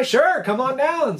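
slow, high, easygoing invitation; breezy welcome. Retold or imitated. Fisherman to returning customer, breezily welcoming. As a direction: imitate an obliging host, drawing it out.

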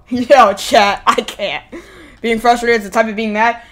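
ordinary talk

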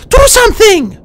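high, loud, frantic squeal; shrill panicked plea. A narrator's reading. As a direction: urgently demand help in a high voice. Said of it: "whispered in a panicked rush".

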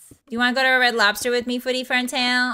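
put-on gushing Valley Girl chatter. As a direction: chat like a Valley Girl, stretching the compliment. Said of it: "five minutes of Valley Girl".

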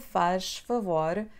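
"faz favor" drawn out slowly, rising at the end; slow demo. Shown as a demonstration. Teacher slows down a phrase for beginners. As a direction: stretch it out for the class. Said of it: "Instead of".

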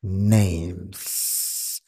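"Names" slowly, ending in a long sustained s; teacher's exaggerated final S on "Names"; final S pronounced. Shown as a demonstration. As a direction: draw out the S closing "Names". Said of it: "Tiene que pronunciarle esa S al final".

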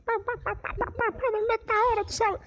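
mucho más aguda y suave, juguetona; pa, pa, pa con vocecita; imitación aguda. Retold or imitated. Imita gesto, voz aguda. (imitando al tipo buscando, voz aguda)

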